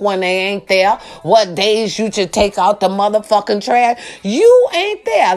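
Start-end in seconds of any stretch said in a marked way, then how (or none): 4.2-5.3 high, slow, pointed; exasperated outburst. Real, not acted. Vlogger scolding parents who are never home, high exasperated outburst. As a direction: jab each word at them.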